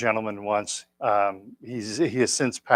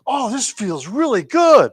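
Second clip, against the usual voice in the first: put-on higher, louder, slow delighted old man voice; imitating old man; 10 semitones higher, 8 dB louder than usual. Retelling the waking old gentleman's delight, high and slow. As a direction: stretch out his pleasure, up high. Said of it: "he's like".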